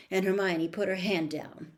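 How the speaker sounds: usual speaking voice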